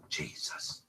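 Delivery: breathy whispered Jesus, copying the recording; soft imitated whisper of the EVP; whispered imitation. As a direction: whisper it like a faint ghost voice. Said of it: "It's going".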